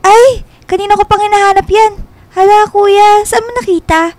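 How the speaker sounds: light up at getting the hat back; relieved excitement. Acted. Jillian thanks the stranger for finding her hat, excited.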